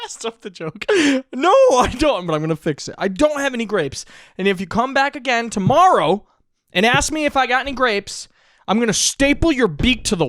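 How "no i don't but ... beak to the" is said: louder, raised threatening rant; angry bartender threat. Retold or imitated. Bartender threatening the duck, loud and raised. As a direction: threaten to staple his beak.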